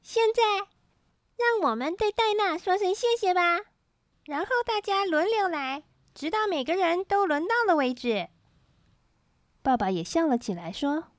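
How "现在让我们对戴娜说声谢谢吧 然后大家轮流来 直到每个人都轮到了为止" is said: put-on higher-pitched cheerful child voice; gleeful child leading the thanks; smiling, happy child. A narrator's reading. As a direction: announce it with a smile, pitched up. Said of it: "笑着说".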